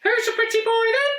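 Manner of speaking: very high, fast squawking parrot voice. A narrator's reading. Parrot squawking at the monster, high and fast. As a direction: chirp it out like a cheeky parrot. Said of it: "the parrot squawked".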